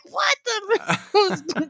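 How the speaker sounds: a high, startled half-curse; mock cursing. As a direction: blurt it out like you're swearing mid-stumble. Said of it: "I'm, like, cursing".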